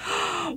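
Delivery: sharp audible intake of breath; shocked little gasp; startled gasp. A narrator's reading. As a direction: gasp in alarm. Housewife startled by a god's arrival.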